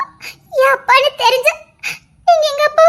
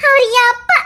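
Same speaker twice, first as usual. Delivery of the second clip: ordinary talk, nothing marked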